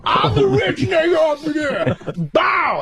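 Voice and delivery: high shouted boast; high and slow, shouting; Tracy impression. As Tracy shouting at the waiter, high boast.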